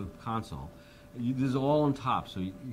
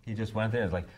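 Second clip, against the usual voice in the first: usual speaking voice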